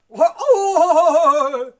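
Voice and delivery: a sudden loud high exclamation; high, loud burst of surprise; loud cry. Real, not acted. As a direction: let out a startled loud cry.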